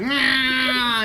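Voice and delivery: long, high whining charge cry; high drawn-out goblin yowl; goblin battle cry. Acted. Game master as goblin running over to swing, high drawn-out cry. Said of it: "he's like".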